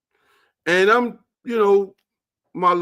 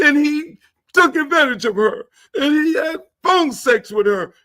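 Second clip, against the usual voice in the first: high, laughing mock cry; mock whine; 11 semitones higher than usual. Mocking the lawyer telling the jury, high laughing whine. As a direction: parody a tearful plea.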